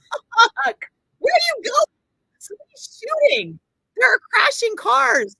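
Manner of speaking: put-on high, loud, frantic cries at the boyfriend; panicked yelling. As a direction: shriek after him in a panic.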